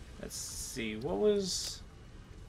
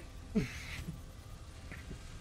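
sudden sneeze; sneezing; 3 semitones higher, 12 dB quieter than usual. Real, not acted. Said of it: "Sorry, I sneezed".

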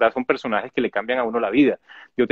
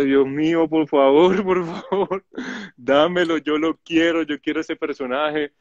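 high-pitched, hammed-up begging prayer with a laugh inside; mock desperate pleading; 4 semitones higher than usual. Actor retelling his daily prayer for the role, playfully pleading. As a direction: beg for it, half laughing at yourself.

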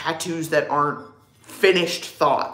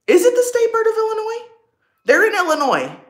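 much higher, louder and quicker, thrilled; excited surprise; 6 dB louder than usual. Real, not acted. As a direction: fire the question off in delighted disbelief. Excited repeat of her question.

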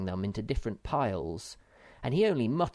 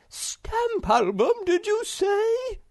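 very high, slow, loud disbelief; can't believe what he just heard; astonished exclamation; 7 dB louder, slower than usual. Acted. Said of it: "exclaimed the Hemulan".

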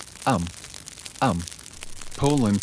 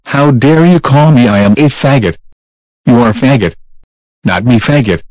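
angry shouting; 13 dB louder than usual. Acted. Acted quarrel continues.

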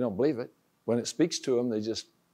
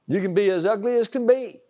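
raised, driving preacher's exhortation; preaching at full force; 5 semitones higher, 5 dB louder than usual. Acted. Preacher exhorting his listeners, loud and insistent. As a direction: push it home like a revival sermon.

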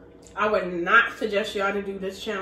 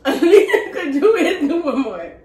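laughing; 4 semitones higher, 6 dB louder, slower than usual. Real, not acted. Laughing through spicy burn.

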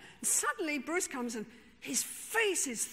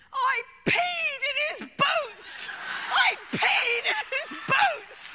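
high, loud, slow gleeful yell; triumphant scream; 13 semitones higher, 8 dB louder than usual. Retold or imitated. Lee confesses to the whole group, gleeful high scream. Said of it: "he screams to the whole world".

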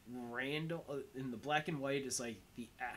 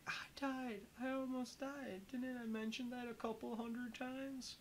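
much higher, whiny mock Dante voice; imitating Dante; 13 semitones higher than usual. Retelling Dante's complaint to Randall in a high mock voice. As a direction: squeak it out as a hammed-up, wounded Dante. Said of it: "and Dante's like".

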